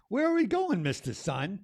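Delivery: high, loud questioning voice; asking the sun. Acted. A small voice calling up to the sun, high and questioning. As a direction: call up to the sun, wide-eyed.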